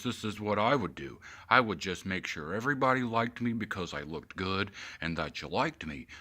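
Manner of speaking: a hint of mocking Obama. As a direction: imitate a self-satisfied Obama. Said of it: "the way he talked".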